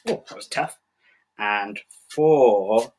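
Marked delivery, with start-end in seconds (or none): 0.1-0.8 breathy aside. Real, not acted. After struggling to core apple.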